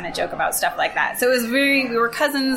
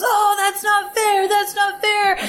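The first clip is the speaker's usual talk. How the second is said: put-on high, slow whine of a hurt little boy; whiny hurt kid; 11 semitones higher, 4 dB louder, slower than usual. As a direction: mimic a kid wailing it's unfair. Cousin after being punched.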